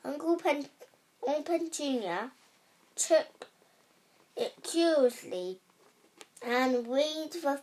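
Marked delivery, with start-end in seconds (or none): none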